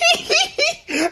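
a sudden high giggle bursting out; cracking up mid-line, can't hold it in; high squealing laugh. Real, not acted. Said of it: "Can't even say that with a straight face".

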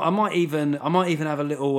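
normal talking voice

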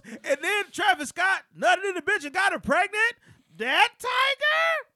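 high, loud, stretched out scorn; incredulous mockery. Retelling the rapper mocking a rival, incredulous. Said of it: "And then he goes".

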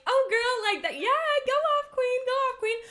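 high-pitched hype for a best friend; bestie voice. Acted. Podcaster demonstrating how she talks to besties, high and gushing. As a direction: gush up high like cheering on a bestie. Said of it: "based on the tone of my voice".

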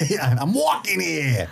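New York tough guy, slow; drawn-out Brooklyn "walking here"; Brooklyn accent. Pretend. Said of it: "my Brooklyn accent".

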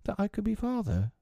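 put-on small, high child's voice; timid, tentative hope; bashful, timid. A narrator's reading. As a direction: finish the request shyly, pitched high. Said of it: "bashfully or timidly".

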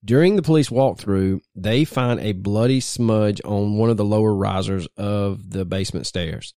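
usual speaking voice

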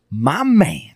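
short micro impression, high and drawn out; Denzel Washington. Trying a micro impression of an actor, Denzel voice. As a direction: stretch it out with a high swoop. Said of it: "try to do a Denzel right now".

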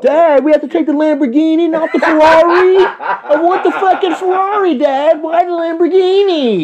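put-on high, pleading spoiled rich kid, half laughing; mock a pampered son begging his dad.

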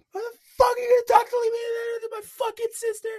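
high, furious yelling at him; angry shout. Retold or imitated. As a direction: scream it like an enraged girlfriend in a bar. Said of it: "She, she, she yelled at me".

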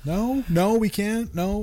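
high, loud disbelieving questions; incredulous pleading. Acted. Boyfriend told no by partner, high incredulous pleading.

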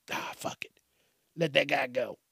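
breathy whispered let-it-go. Pretend. Whispering to himself about the driver who cut him off. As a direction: whisper it to yourself, shrugging it off. Calm self-talk letting anger go.

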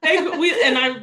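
high, laughing through the words. Real, not acted. Retelling her plea to Jeff, laughing high. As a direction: crack up as you start the line.

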